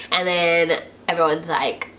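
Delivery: ordinary delivery, nothing marked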